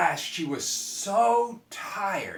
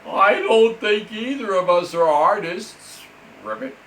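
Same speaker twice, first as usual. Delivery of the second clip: loud, higher, dramatic frog complaint; lamenting frog; 4 semitones higher, 10 dB louder than usual. Acted. Frog complaining to the girl, loud and dramatic. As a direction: wail it like a theatrical frog.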